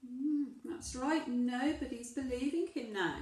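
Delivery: ordinary delivery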